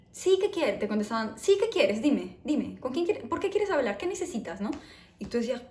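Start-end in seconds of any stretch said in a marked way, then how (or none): 0.1-0.7 imitating a brusque Madrid receptionist, higher and louder; curt reply. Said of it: "por qué la gente es tan maleducada". 1.4-4.7 imitating a brusque Madrid receptionist, higher and louder; curt reply. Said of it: "por qué la gente es tan maleducada".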